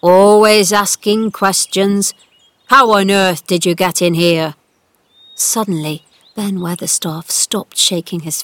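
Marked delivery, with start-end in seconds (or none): 0.0-4.5 loud, indignant telling-off; cross scolding. A narrator's reading. Old gardener scolding Mary from a ladder, cross. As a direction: complain loudly and crossly. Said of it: "looking crossly over the wall".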